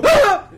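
sudden high loud shriek; scream of fright. Retold or imitated. Comic voicing startled passersby, high loud shriek. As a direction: shriek in horror. People seeing burning naked man.

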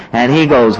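ordinary talk, nothing marked

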